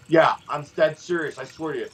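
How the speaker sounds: ordinary talk, nothing marked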